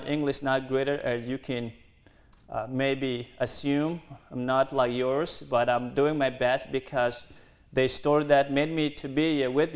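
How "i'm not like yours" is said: usual speaking voice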